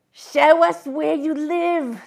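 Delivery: put-on a convict interrogating the boy; gruff convict demand. A narrator's reading.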